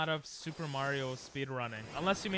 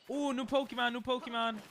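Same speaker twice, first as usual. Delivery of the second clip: excited; 9 semitones higher than usual. Real, not acted. Spots a new creature.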